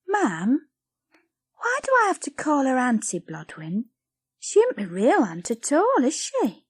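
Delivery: put-on a girl probing her mother; young Lizzie, questioning.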